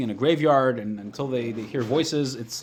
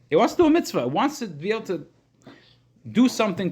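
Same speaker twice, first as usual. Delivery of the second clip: excited emphasis; 7 semitones higher than usual. Acted. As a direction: lift it up with eager urgency. Teacher stresses soul's longing.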